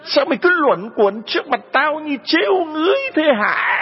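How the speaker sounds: shrill scolding. Acted. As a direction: screech at her like a furious stepmother. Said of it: "mụ sấn sổ vào ... mà chu chéo lên". (dì ghẻ chu chéo, mắng chửi the thé)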